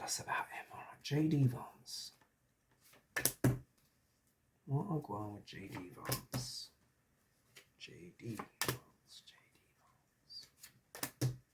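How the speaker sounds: hushed whisper to the spirit. Real, not acted. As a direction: breathe the words as if calling a spirit. Summoning the spirit over tarot cards.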